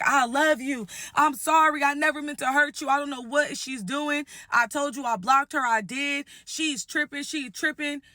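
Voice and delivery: high, whiny imitation of a begging man; mock pleading. Fiance begs the bride to believe him, mock-pleading.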